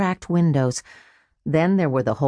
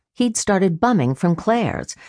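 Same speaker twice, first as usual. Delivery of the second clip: ordinary delivery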